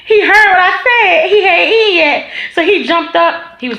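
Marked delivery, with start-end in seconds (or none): none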